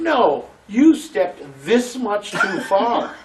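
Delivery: high, loud, drawn-out scolding; mock an exacting director's correction; slow, emphatic imitation of a fussy director. Acts exacting director correcting his blocking.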